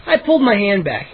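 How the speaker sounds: usual speaking voice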